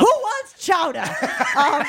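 laughing high joke. Real, not acted. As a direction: giggle the question out.

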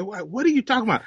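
high, quick baffled question; mock bewilderment, rattled off; puzzled reaction. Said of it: "and they go".

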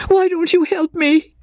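very high, fretful woman's voice; impatient plea. A narrator's reading. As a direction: demand help in a high, distressed voice. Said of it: "shook her head impatiently".

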